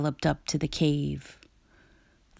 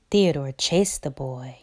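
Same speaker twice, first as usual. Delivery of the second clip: usual speaking voice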